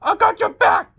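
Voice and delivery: high, loud rallying cry; high heroic yell. Acted. Soldier yelling to his partner fighting off a gnome, high rallying shout. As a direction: shout it to a comrade under attack. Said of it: "Dimension Soldier number one yell".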